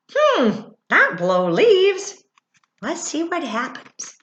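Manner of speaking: high, slow teasing hint; knowing singsong hint; playful hint. Real, not acted. Teasing question to kids.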